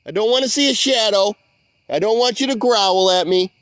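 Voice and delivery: high, loud calls into the dark, pleading; loud coaxing call. Real, not acted. Coaxing an unseen creature out of the swamp, loud and pleading. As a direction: coax him out, calling loudly. Calling to hidden creature Kyle.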